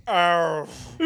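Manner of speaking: loud, high animal cry; imitated cat yowl. Man imitating his cat giving birth, loud yowl. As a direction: belt out a cat in labour. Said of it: "the cat just starts going".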